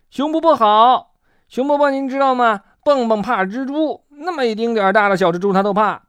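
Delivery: high, eager little tattletale; tattling. A narrator's reading. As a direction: blurt it out to tell on a friend. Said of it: "跳跳立刻去向熊婆婆揭发".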